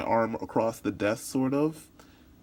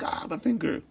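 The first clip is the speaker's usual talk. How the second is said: startled interpreter; 8 semitones higher than usual. Acted. Interpreter startled seeing the finger.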